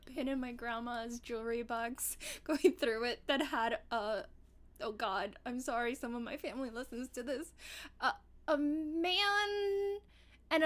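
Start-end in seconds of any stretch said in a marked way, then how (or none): none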